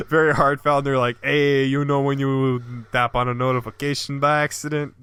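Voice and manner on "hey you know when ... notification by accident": put-on a light New York Italian accent, raised; mock New York Italian. Reading cohost's email reply in light New York Italian. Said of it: "Why are you reading it in an accent?".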